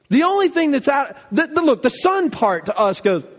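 normal talking voice

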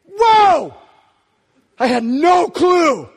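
huge, high, drawn-out shout of amazement; stunned awe. Acted. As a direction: act out being blown away, at full volume. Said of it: "you're gonna go".

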